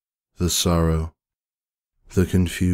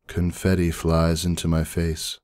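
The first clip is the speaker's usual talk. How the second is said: usual speaking voice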